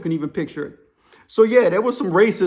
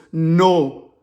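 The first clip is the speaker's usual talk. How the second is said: put-on heavy, drawn-out African-accented words; imitated African accent; 2 semitones lower, 4 dB louder, much slower than usual. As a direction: play the scared African caller. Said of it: "The African dude's whispering".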